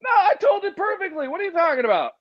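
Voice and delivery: defending his butchered story in a high squeak; mock indignant. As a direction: protest in a hammed-up high squeal.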